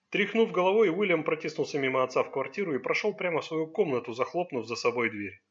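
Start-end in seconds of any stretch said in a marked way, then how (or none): none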